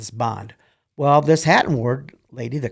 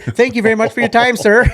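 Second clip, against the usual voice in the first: loud, higher, fast indignant thanks; indignant send-off; 11 semitones higher, 11 dB louder than usual. Retold or imitated. Refusing the commander, she storms off with a loud, quick thank-you. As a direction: snap out a huffy farewell.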